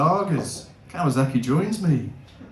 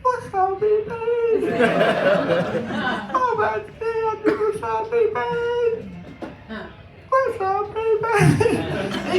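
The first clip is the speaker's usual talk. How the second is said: much higher, sing-song dry-mouthed mumble with laughs; parched mumble; much slower than usual. Retold or imitated. Patient mumbling to the doctor, high, parched croon. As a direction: croon it like your tongue is dust. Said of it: "All I could do was sort of mumble".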